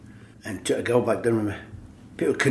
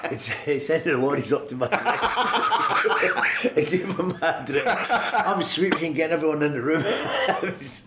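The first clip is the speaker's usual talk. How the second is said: real laughter through the words, pitched up; can't stop laughing as he tells the punchline; higher, slow, giggling delivery; laughing while talking; 4 semitones higher, slower than usual. Real, not acted. Laughing retelling of prank.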